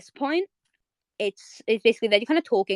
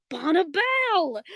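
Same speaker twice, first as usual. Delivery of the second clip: mock shout; 8 semitones higher, slower than usual. Retelling Marceline calling out Bubblegum, mock shout. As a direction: call the name out slow and high. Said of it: "like, she shouts it".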